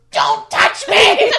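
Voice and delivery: high, loud, slow hammed-up shriek; laughing melodramatic yell; mock shriek. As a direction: overact the heroine's panic. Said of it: "she goes".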